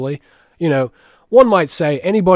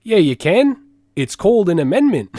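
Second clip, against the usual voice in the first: put-on raised Australian-accent comic delivery; Jim Jeffries; 4 semitones higher than usual. Answering in the stand-up bit, Australian accent. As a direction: retort in a mock Australian accent. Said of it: "his voice is very easy to copy".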